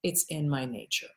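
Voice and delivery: low, very soft reply; matter-of-fact scorpion. Acted. Scorpion to the drowning toad, low quiet resignation. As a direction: answer quietly, pitched right down. Scorpion's calm answer in fable.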